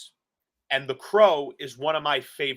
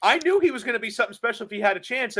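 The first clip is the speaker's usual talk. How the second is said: higher, fast lightly voiced Cornette; Cornette impression; 8 semitones higher, faster than usual. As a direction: rattle it off as Cornette, a bit higher. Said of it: "he tells me".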